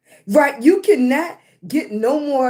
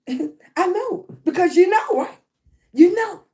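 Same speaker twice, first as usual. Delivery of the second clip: playful confidence; 7 semitones higher, 5 dB louder than usual. Real, not acted. Laughing, echoing the niece.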